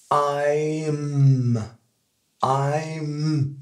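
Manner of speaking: "I'm" stretched out with a long humming m; final M held. Shown as a demonstration. Teacher showing the word ends in M, drawn out. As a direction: draw out "I'm" slowly, lips closing on m. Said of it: "ça se termine par un M".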